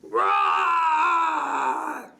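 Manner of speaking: big loud roaring lion shout. Shown as a demonstration. Librarian showing children how a lion roars, loud roar. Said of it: "You can roar like a lion".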